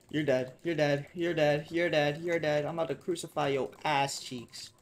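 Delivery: loud, rapid-fire taunting repeats; loud game threat. Pretend. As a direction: hammer out the playful threat loudly.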